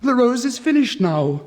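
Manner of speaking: high, loud joyful cry; tree crying out. A narrator's reading. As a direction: call it out to the whole garden. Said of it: "cried the tree".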